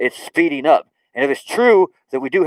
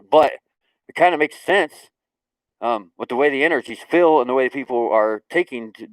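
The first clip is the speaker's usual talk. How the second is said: usual speaking voice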